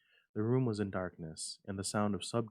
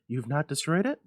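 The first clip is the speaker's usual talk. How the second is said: urgent; 7 semitones higher, 10 dB louder than usual. Acted. Urgent wife, high.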